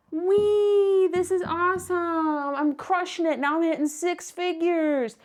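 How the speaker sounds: high squealed wee, then slow gloating; mock thrill. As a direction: ride the roller coaster top with fake glee. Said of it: "you're like".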